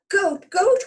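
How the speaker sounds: high, loud call; calling up the hill to Goat. Acted.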